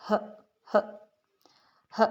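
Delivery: "ह" breathed out on its own with pauses between; teacher repeating the "ह" sound; phonics sound demo. Shown as a demonstration. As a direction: model the "ह" sound. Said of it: "H की sound है".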